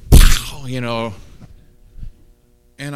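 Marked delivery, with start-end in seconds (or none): 0.1-0.7 loud unvoiced pow like a blow; smack sound. Preacher mimics the Holy Spirit's blow with a sharp pow. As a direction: bark out a sharp pow like a two-by-four hit. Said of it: "just kinda goes".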